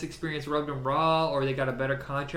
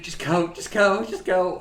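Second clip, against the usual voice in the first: higher, louder plea said through giggles; laughing through the line; 5 semitones higher, 8 dB louder than usual. Retold or imitated. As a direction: beg them to leave while cracking up. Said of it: "under my laughs".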